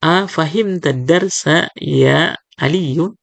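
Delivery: A fahim tadarsa ya Aliyu read slowly; slow classroom example question; slow model question. Shown as a demonstration. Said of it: "Kalau kita bertanya".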